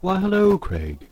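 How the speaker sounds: mock announcer greeting, pitched high; a hammed-up radio host hello; bright showman welcome; podcast voice. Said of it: "then I'll put on my podcast voice".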